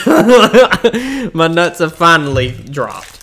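slow, laughing through the words; chuckling while saying it. Real, not acted. Laughing at own joke.

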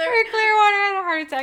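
high, loud, crying wail. Shown as a demonstration. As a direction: wail it like mock anguish. Tongue twister drama take.

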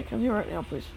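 ordinary delivery

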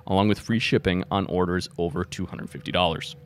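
ad read. Acted. Sponsor ad read.

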